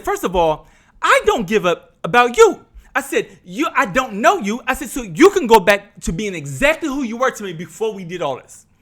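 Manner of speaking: telling off the cousin, mildly angry; retold anger. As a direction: put him in his place. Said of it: "a lot of yelling".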